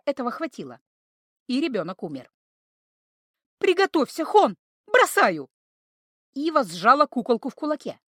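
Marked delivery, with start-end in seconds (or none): 3.6-5.5 calling out. A narrator's reading. Warning before the throw.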